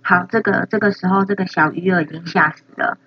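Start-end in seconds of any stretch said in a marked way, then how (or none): none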